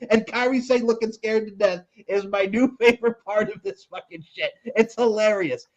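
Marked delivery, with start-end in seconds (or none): none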